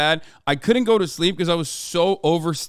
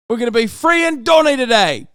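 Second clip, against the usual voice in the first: mock shout; 6 semitones higher, 5 dB louder than usual. Shouted gangster bit.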